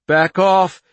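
loud desperate yell; screaming. A narrator's reading. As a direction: scream it at the power. Child screaming at her power.

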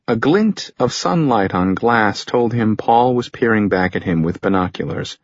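ordinary delivery, nothing marked

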